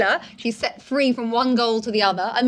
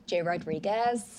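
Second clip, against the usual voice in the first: soft, understated American accent; low-key American, slowed a little and softly lifted at the end; 4 semitones lower, 9 dB quieter, slower than usual. Shown as a demonstration. Guest answering host in accent duel, soft American. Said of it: "so I would go".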